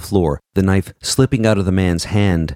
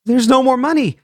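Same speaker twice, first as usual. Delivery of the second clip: scream. A narrator's reading. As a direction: scream it out in panic. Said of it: "she screamed".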